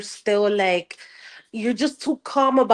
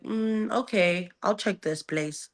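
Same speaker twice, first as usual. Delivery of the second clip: mock nonchalant; 3 semitones lower, 9 dB quieter, slower than usual. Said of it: "you sit in there like".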